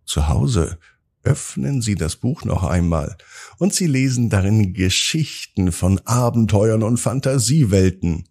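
usual speaking voice

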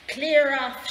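loud, rude storybook shout; abrupt loud brush-off. A narrator's reading. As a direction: shout to send someone packing. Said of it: "shouted the rude little pig".

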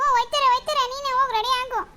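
high, sulky weepy snap; tearful retort. Acted. Daughter sulkily snapping at mother through tears. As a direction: throw it back while crying.

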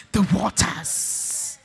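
very slow, stretched-out words; preacher lingering on the answer; drawn-out emphasis. Real, not acted. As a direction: draw out each word for the congregation. Draws out answer to own question.

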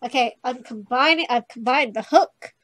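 loud step-by-step announcement; loud announcing. Real, not acted. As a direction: declare each move loudly and emphatically. Loudly announces each game move.

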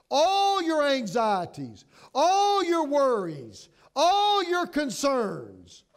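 slow, loud, hitting each "all"; emphatic list. Acted. Preacher urging the flock to cast cares, slow and loud. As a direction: hammer "all" every time, slowly.

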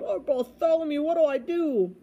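high, helpless wail. A narrator's reading. Stuck trumpeter begs the boy, wailing. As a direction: plead in a wailing tone. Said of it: "the trumpeter wailed".

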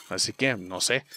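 ordinary talk

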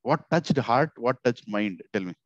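usual speaking voice

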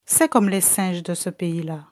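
ordinary delivery

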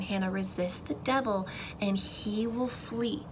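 slowed, quiet, deliberate repeat; slow, soft insistence. Retold or imitated. Mom ministering to her daughter, slow soft insistence. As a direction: press it home slowly and softly. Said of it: "she says it again".

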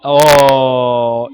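a big drawn-out ooh, caught off guard; sudden loud gamer ooh; loud startled ooh. Real, not acted. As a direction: let out a loud surprised oo. Reacting to the game.

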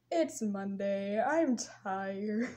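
low, slow, weary reply; retold lame excuse, drawn out low; deadpan excuse. Patient excusing herself to the nurse, deadpan.

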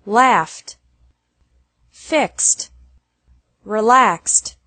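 "laughed" said alone, ending in F-T. Shown as a demonstration. Teacher drilling F and X endings, -ed pronounced as T. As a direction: say "fixed" with a final K-S-T. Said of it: "E D is pronounced like T".

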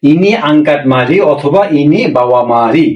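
ordinary talk

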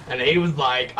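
usual speaking voice

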